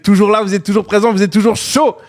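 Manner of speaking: pumped-up host getting a bit shouty; excited half-shout. Real, not acted. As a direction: cheer the live audience on, voice climbing. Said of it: "Désolé si je crie un petit peu".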